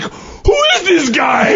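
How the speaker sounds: high, slow mock cry; stretched high incredulous holler; delighted amazement. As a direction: squeal it in imitated hilarity. Gangsters' retold delighted amazement.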